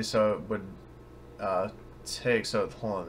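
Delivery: ordinary talk, nothing marked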